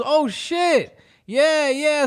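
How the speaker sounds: high-pitched awkward mock awe; feigned amazement. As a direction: imitate a friend playing along with the trick. Mo feigning amazement at wrong card.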